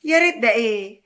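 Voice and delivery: loud indignant echo; scoffing retort. Acted. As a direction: throw her words back at her. Scoffing at her doubt.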